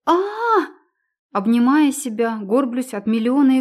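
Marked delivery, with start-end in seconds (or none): 0.0-0.7 high gasping cry at icy water; shocked scream. Acted. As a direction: shriek from the cold shock. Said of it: "врубает ледяную воду".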